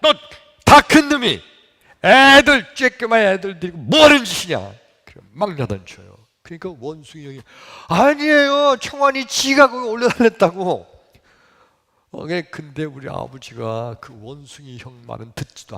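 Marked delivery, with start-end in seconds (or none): 0.0-4.6 loud, high-pitched father's telling-off; acted furious shout; angry scolding. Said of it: "야단을 치는데". 7.9-10.9 high, loud denial of blame; acted aggrieved shout; indignant protest. Scolded boy protests his innocence.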